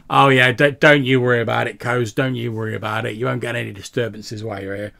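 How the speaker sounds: fawning barkeep, loud at first then settling; eager barkeep. Acted. Barkeep reassures after testing credit.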